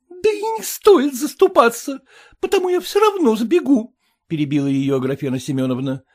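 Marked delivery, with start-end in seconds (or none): 0.1-4.0 high, loud, tearful wife; aggrieved young wife voice, much higher. Acted. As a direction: complain like an upset young bride. Wife's voice.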